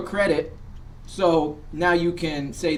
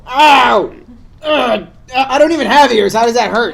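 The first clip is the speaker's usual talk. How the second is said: whiny puppet voice; 7 semitones higher, 10 dB louder, slower than usual. Acted. Puppet complaining loudly.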